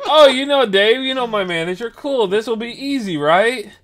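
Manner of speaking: very loud, high-pitched naive delight; mock your own oblivious enthusiasm; hammed-up, blissfully unaware excitement; clueless dumbass. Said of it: "Like a fucking dumbass".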